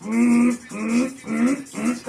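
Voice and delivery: mock fussy cat refusing food; picky cat's mm mm. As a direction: turn your nose up like a picky cat. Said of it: "then was like".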